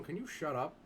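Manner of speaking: normal talking voice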